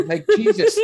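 high, exasperated cry of Jesus; mock exasperation. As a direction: throw up your hands at the guy.

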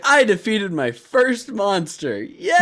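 high, slow, proud announcement ending in a squeaky yay; mock triumphant cheer. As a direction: cheer like a kid who won. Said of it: "and it's like".

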